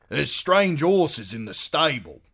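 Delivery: high, loud, slow announcement; announcing. A narrator's reading. Husband bustling in with news, loud announcement. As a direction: proclaim it as news, drawn out. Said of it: "he announced".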